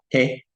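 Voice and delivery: "T" said with the tongue on the roof of the mouth; English-style "T" off the palate; palatal T. Shown as a demonstration. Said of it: "se pronuncia con la lengua y, y el cielo de la boca".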